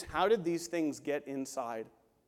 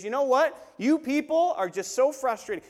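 exasperated snap; 6 semitones higher, 5 dB louder than usual. Retold or imitated. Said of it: "He lowered his standard and says".

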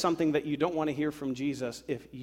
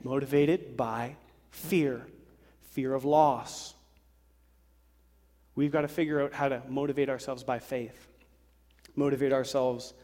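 normal talking voice

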